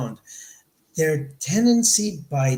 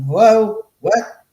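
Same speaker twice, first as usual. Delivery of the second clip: loud, high startled call into the dark; jolted awake, calling out at a sound; startled hello; 6 semitones higher, 10 dB louder, slower than usual. Retold or imitated. Hearing voices at night, a loud startled call.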